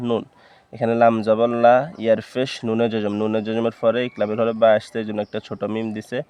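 ordinary delivery

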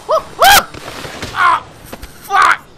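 loud high yelp; shouted cry. Real, not acted. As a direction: yell in shock as you crash. Scooter crash.

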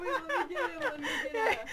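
high-pitched laughing apology. Real, not acted. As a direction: giggle it out helplessly. Laughing while cleaning spit.

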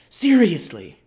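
insistent "Seriously" with a falling finish; emphatic. Acted. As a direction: land "Seriously" hard and let it fall. Pleading letter, emphatic word.

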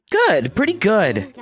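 lightly voiced kid, slow and high; character voice. The game kid repeating his answer to a neighbor. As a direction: drawl a smug little answer.

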